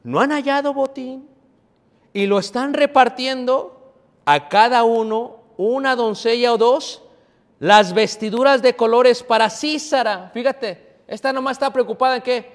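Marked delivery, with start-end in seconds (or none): 0.0-10.3 scripture reading. Real, not acted. Preacher reads the mother's words about booty, high emphatic scripture reading. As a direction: proclaim the verse phrase by phrase.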